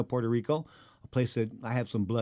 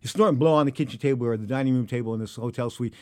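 usual speaking voice

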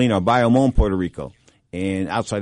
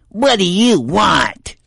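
much higher, louder, very slow drawn-out snap; annoyed ex-wife; 10 semitones higher, 7 dB louder, much slower than usual. Retold or imitated. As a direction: drag it out like a fed-up ex. Ex-wife answers the phone curtly.

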